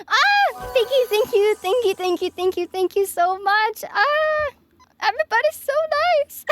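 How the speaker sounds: high, excited rapid thank-yous; delighted squeal. Real, not acted. Streamer thanking generous viewers, high excited squeal. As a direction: squeal with joy at their kindness.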